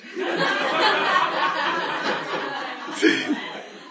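high, drawn-out laughter; extended amused laugh; long laugh. Real, not acted. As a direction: laugh hard at the scene. Laughs at the story.

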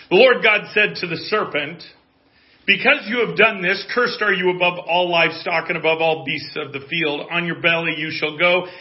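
high, loud pulpit reading; forceful, declaimed Bible reading; scripture reading. Real, not acted. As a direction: proclaim the verses to the congregation. Reads scripture aloud.

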